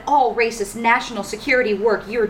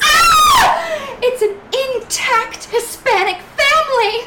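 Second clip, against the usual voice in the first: high panicked shriek; shrieking alarm; 12 semitones higher, 5 dB louder, slower than usual. Acted. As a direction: scream it out in horror. Woman shrieks at family.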